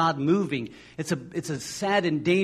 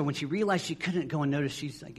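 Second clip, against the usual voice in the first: ordinary talk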